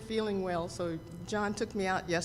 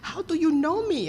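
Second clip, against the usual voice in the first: much higher, imitated African accent; 10 semitones higher, 4 dB louder than usual. Thrift store woman greeting Barney in an imitated African accent. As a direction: voice the woman with an African accent, high and bright with joy. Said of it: "in her African dialect".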